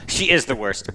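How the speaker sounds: ordinary delivery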